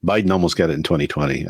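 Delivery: normal talking voice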